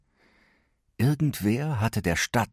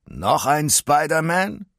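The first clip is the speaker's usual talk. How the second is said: higher, slow sneering villain voice; slow, mocking villain taunt; mocking Electro; 7 semitones higher, slower than usual. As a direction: sneer down at a new hero. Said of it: "Electro grinste höhnisch".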